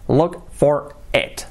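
slow, clear teacher model; slow English demo line; slow model phrase. Shown as a demonstration. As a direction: spell it out slowly for learners. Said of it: "Solo funciona de esa forma".

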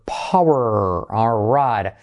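normal talking voice

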